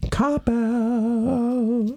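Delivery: very high, put-on squeal; mock taunt. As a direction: taunt in a sustained falsetto. Mock taunt in retold scene.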